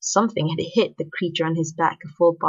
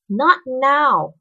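high, louder firm refusal; shutting him out; 8 semitones higher, 5 dB louder than usual. A narrator's reading. Girl refusing to talk to her schoolmate, firmly shutting him out. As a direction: close the conversation off, pitched up. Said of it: "Her voice was a door closing".

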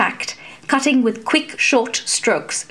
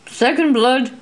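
higher, lightly amused call; amused referee calling the point; 4 semitones higher than usual. A narrator's reading. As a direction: announce the score with a touch of amusement. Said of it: "Broad Benjamin called".

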